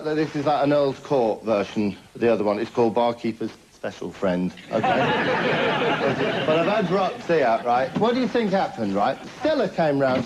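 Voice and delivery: usual speaking voice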